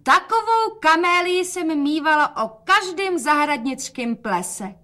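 high, slow, drawn-out call. A narrator's reading. Mother admires the camellia aloud, calling out. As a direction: call it out high and stretch the words. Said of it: "a volala".

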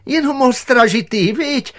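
very high, strained nonchalance; forced carefree tone. A narrator's reading. Director plays down the dog to Ježek, mock carefree. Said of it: "nasadil bezstarostný tón".

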